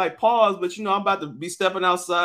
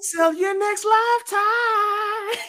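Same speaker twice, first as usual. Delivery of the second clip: high, slow hammed-up seller; mock seller voice; slower than usual. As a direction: drawl it like a mock salesman.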